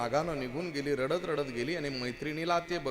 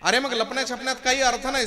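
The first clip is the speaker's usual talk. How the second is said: ordinary talk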